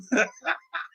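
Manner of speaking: high, trailing chuckle; mocking laugh. Real, not acted. Streamer laughing at a troll's comment, mocking laugh.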